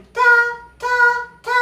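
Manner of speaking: "ta" repeated high and level, almost sung; Mandarin first tone. Shown as a demonstration. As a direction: chant each "ta" on one sustained high pitch. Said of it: "lleva el primer tono". ("ta" en primer tono, agudo y sostenido)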